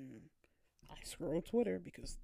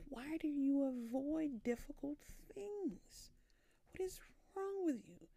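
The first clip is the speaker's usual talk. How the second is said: high, slow self-scolding; mock-stern inner voice; 9 semitones higher than usual. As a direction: nag yourself like a stern inner critic. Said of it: "But in my head, I'm like".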